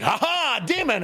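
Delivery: high mock-heroic exclamation; hammed-up gleeful challenge; triumphant cry. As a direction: crow it high like a cartoon hero. Acting out character confronting a demon.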